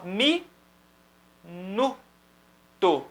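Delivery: "Mi, nu, to" as isolated syllables with long pauses; sillabe staccate. Shown as a demonstration. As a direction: give each syllable of "Mi, nu, to" its own single movement. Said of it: "um movimento só".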